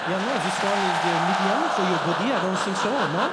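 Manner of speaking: fast, loud French shop clerk; French salesman roasting me; French accent. Retold or imitated. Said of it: "He's like".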